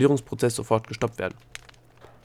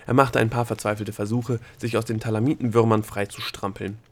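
ordinary delivery

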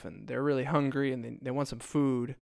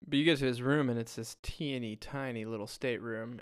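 ordinary talk

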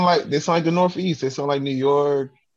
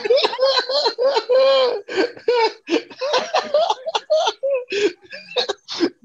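long, high burst of laughter; helpless laughter. Real, not acted. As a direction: laugh uncontrollably, on and on. Cracks up over Minnesota.

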